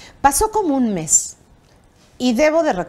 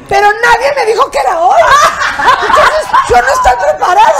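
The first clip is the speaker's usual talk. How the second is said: very high, loud mock hysterics; comic shouted imitation of distress; hammed-up wailing complaint; 10 semitones higher, 9 dB louder than usual. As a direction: protest like a panicked diva. Felicia's wailing objection, acted out.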